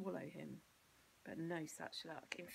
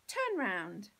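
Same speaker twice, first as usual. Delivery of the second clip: mother's order; 9 dB louder than usual. Acted.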